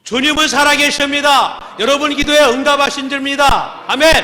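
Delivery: high, loud proclamation; full-force preaching. Acted. Pastor proclaiming to the congregation at full force. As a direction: proclaim it to the whole church.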